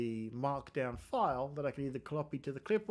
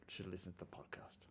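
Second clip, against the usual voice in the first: whispered aside; 7 semitones lower, 16 dB quieter, faster than usual. Real, not acted. Coder plugging his podcast to viewers, whispered aside. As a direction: drop to a sly whisper for the plug.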